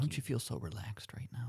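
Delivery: soothing hushed announcer whisper; golf commentator whisper. Acted. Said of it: "they do the whisper".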